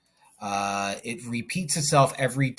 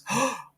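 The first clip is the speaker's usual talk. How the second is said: quick high startled gasp; sharp gasp; 11 semitones higher than usual. Real, not acted. Teacher catching her own slip, sharp startled gasp. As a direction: gasp as if you caught yourself.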